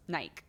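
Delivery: "Nike" rhyming with bike; one-syllable pronunciation. Shown as a demonstration. Answering chat's question, "Nike" pronounced to rhyme with bike. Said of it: "pronounces Nike like bike".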